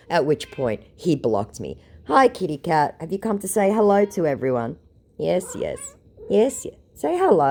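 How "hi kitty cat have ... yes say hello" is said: high, sweet voice for a pet; affectionate cat greeting; talking to the cat. Real, not acted. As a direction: coo at the kitty.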